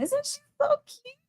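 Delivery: squeaky high doggy-talk voice. Real, not acted. Cooing over her dog on camera.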